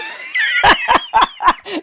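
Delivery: high, loud burst of laughter; loud real laughter. Real, not acted. Cracking up at his own joke to the friend, loud real laughter.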